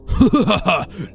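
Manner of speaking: loud, high maniacal cackle; evil laugh. Acted. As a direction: laugh like a killer. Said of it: "The man said".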